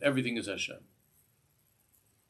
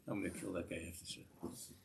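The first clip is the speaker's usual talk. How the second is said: softer, muttered mock threat under the breath; mock annoyed grumble; 3 semitones lower, 12 dB quieter than usual. Annoyed man in the sukkah grumbling about the electrician, soft mock mutter. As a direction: grumble like a fed-up guy in the sukkah. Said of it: "And you're annoyed because".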